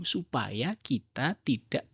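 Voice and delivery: ordinary delivery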